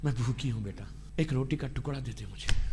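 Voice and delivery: whisper-thin voice of a starving mother asking her son for bread; mother begging her son for a crust, faint and broken; hungry mother begging. Retold or imitated. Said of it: "اگر ماں بولے کہ میرے بیٹے".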